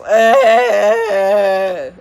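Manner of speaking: loud ugly groan of cringe. Real, not acted. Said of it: "such an ugly sound".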